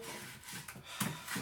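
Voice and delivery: long breathy sigh; weary exhale; exasperated sigh. Real, not acted. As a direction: let out a fed-up sigh. Fed up with own clumsiness.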